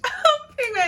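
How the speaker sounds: laughing while saying it, high pitched. Real, not acted. Laughing as she tells viewers about peeing on the road. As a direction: giggle through the words. Laughs mid-line retelling.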